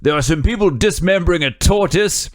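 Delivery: high, overacted British storyteller; bad British actor. Podcaster retells islander's shark story, hammed-up British actor voice. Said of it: "he's the worst actor I've seen".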